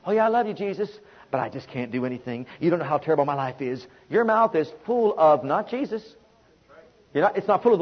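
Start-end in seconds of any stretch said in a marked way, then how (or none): 0.0-3.9 mock whiny complaint, trailing quieter and fast; half-hearted doubter shrugging off faith; lightly voiced self-pitying churchgoer; mocking defeatist believer. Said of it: "people have failed to realize this".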